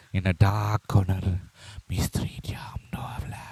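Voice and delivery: slow, low murmur fading into a whisper; mock-mysterious whisper. Host setting a moonlit dark-corner scene, mock-mysterious whisper. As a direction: let it sink slowly into an eerie whisper.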